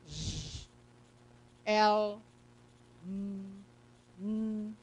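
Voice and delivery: consonants sounded out instead of named; isolated speech sounds, one by one; consonant sounds demonstrated. Shown as a demonstration. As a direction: hold each consonant sound on its own. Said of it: "se eu for pronunciar, tá, será dessa forma".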